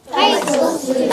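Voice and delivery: loud class chorus echoing the teacher; the whole class calls it back together; chorus repeat. Shown as a demonstration. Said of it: "Everyone say".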